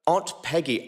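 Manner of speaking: ordinary delivery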